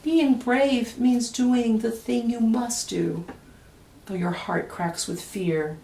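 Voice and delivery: plain reading voice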